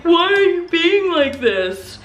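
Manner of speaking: high-pitched mock complaint; mocking boyfriend imitation.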